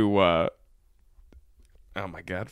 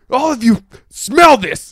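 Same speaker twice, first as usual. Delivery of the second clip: high, loud order; mock commanding yell; 11 semitones higher, 8 dB louder than usual. Boss to kitchen staff over rotten shrimp, mock yell. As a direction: imitate a disgusted boss ordering everyone over. Retold Taffer confronting the staff.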